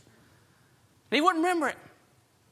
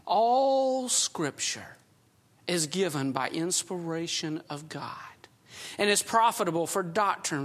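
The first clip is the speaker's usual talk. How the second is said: scripture reading; 4 semitones lower, slower than usual. Acted. Preacher reads scripture to the congregation, slow and low. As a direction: read it deliberately, weighing each phrase.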